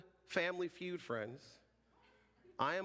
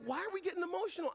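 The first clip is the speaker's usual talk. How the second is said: high, fast mock question; quick, high-pitched retold query from a bemused partner; imitating puzzled partner; much faster than usual.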